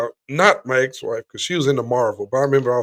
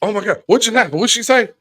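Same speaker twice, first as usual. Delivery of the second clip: higher-pitched mock flustered questions; mimicked pestering viewer; 7 semitones higher, 3 dB louder than usual. Co-host mocks someone asking questions during a film, mimicked fluster. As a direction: rattle off pestering questions, imitating a confused viewer.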